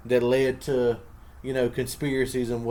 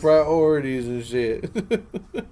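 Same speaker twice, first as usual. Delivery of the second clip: laughing; 3 semitones higher, 9 dB louder, much slower than usual. Real, not acted. Laughing at a friend's excuse.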